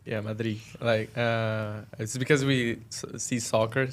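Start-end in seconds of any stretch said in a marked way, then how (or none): 0.2-0.6 "Madrid" ending open on the I; dropped final D. Shown as a demonstration. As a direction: quietly confirm "Madrid" as "mah-DREE". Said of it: "it stops at the I".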